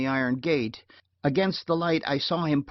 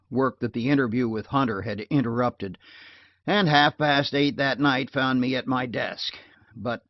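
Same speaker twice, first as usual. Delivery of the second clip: ordinary delivery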